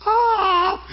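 high sobbing cry out; overwhelmed cry. Real, not acted. Preacher overcome before God, sobbing cry. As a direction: let out a high overwhelmed sob. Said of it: "I was so freaked out".

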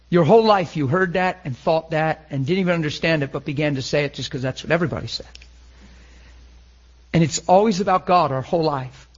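ordinary talk

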